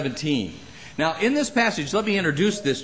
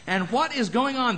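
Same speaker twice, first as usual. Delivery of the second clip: ordinary talk, nothing marked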